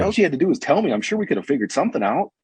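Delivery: like it could all have been avoided; exasperated. Retold or imitated. Cop tells the arrestee.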